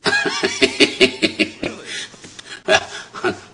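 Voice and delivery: high burst of laughter; real laughter. Real, not acted. Comedian reacts to guest's wedding quip, real laughter.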